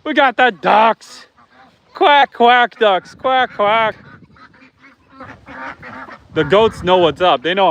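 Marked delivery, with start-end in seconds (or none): 1.9-2.8 playful quack, a little louder; mock duck quack. Showing viewers the ducks with a playful quack. 3.1-4.0 mock duck quack. Showing viewers the ducks with a playful quack.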